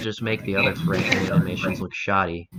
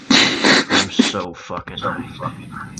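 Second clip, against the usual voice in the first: slow whisper; 4 dB louder, much slower than usual. Real, not acted.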